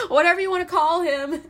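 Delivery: giggling, brushing off the insult; laughing. Real, not acted. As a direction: laugh it off after the rant. Laughing after her own outburst.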